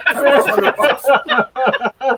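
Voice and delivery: slow, high, loud laughing repeat; laughing emphasis. Real, not acted. Bowler to host, mocking the tiny prize, slow laughing. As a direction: draw it out through a laugh.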